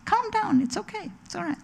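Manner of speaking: high, soft reassurance, acted out; calming others. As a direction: soothe them with a light, hushed high voice. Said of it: "They're really calm and they make other people calm too".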